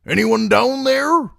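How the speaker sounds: high, loud call down the steps. A narrator's reading. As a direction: shout into the dark below. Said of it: "he called again".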